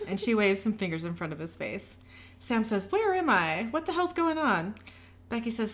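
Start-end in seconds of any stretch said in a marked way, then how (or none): 2.9-4.7 higher-pitched, bewildered demand; alarmed confusion. A narrator's reading. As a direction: wake up confused and demand answers. Sam wakes up tied to bed.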